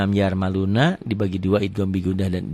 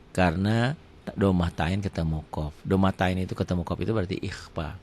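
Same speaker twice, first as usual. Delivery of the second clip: ordinary talk, nothing marked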